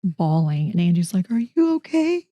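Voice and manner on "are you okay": much higher, rising concerned question; high-pitched check on someone crying. Retold or imitated. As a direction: imitate a worried friend, pitched right up. Friend reacting to teller bawling.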